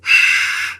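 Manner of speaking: breathy unvoiced sigh; lonely sigh. Little Owl missing friends in the empty forest, sighing. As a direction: let the loneliness out in one breath.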